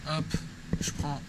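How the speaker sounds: normal talking voice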